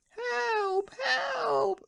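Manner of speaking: very high frantic cries for help; shouting wildly. Acted. Husband runs out of the house shouting wildly for help. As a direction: yell for help at the top of the voice. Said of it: "screamed the man".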